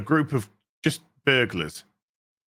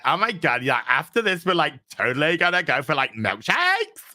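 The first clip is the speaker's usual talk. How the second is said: lightly mocking valley girl; a hint of ditzy California valley girl; 3 semitones higher than usual. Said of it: "valley girl from California".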